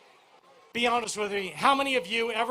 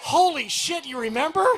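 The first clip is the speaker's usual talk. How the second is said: excited surprise; 5 semitones higher, 5 dB louder than usual. Real, not acted. Crowd remembers his radio catchphrase.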